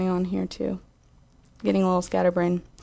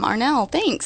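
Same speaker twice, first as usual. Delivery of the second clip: ordinary delivery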